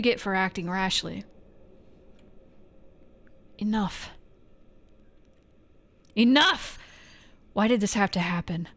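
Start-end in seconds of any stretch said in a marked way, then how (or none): 6.1-6.8 sudden loud yell, pitch jumping up. Acted. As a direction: explode with the word Enough!. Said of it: "She's mad because I yelled".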